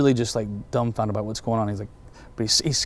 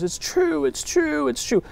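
emotional high voice; 14 semitones higher, slower than usual. Retold or imitated. Weeping woman confirms each point.

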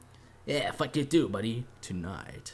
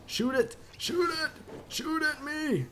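high excited taunt; 12 semitones higher than usual. Real, not acted. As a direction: egg them on, high and bright. Taunting enemy to shoot.

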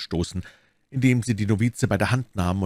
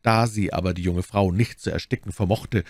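plain reading voice

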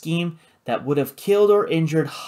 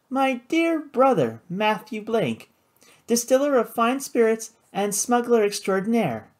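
half-suppressed amusement; 9 semitones higher than usual. A narrator's reading. Sister introducing her brother, amused and mock-grand. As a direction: announce him with teasing ceremony, pitched high. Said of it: "her mouth quivered with a half-suppressed laugh".

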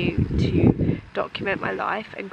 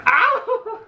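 high loud laughing squeals; squealing laughter; 13 semitones higher, 7 dB louder, slower than usual. Real, not acted. Dog game, squealing.

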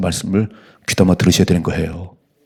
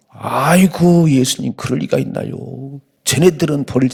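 higher, louder, slow wail of protest; 6 semitones higher, 4 dB louder, slower than usual. Retold or imitated. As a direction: cry out like Peter refusing to believe. Peter protesting, loud high wail. (높고 크게 울부짖듯 항의하는 베드로)

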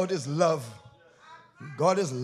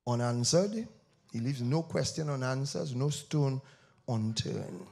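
hushed preaching; 7 semitones lower, 6 dB quieter than usual. Acted. Preacher urges the church to face God's judgment, hushed. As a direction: draw them in close and quiet.

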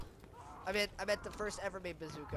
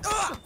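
short, high, loud cry; startled yell; 11 semitones higher, 7 dB louder than usual. Real, not acted. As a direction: yelp on being killed. Killed suddenly in game.

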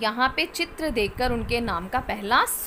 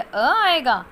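ordinary delivery

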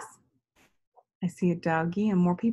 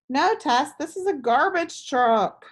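a hint of a kind friend correcting her; patient boy answering; 7 semitones higher than usual. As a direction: friend Gus gently correcting Tess, lightly voiced. Gus answers Tess in story.

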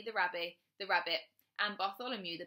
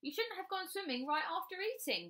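high-pitched told-you-so reminder; reminding; 11 semitones higher than usual. A narrator's reading. Bradley to his drowning rabbit, reminding her. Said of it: "Bradley reminded her".

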